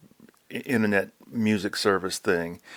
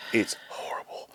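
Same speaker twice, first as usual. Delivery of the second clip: whispered disgust; 8 dB quieter than usual. Real, not acted. Complaining about new office.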